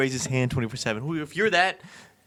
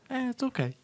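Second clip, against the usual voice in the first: high, soft shrug of a voice; mock indifference; 11 semitones higher, 6 dB quieter than usual. Classmates shrugging off his confession, mock-indifferent. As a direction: imitate a classmate letting it go.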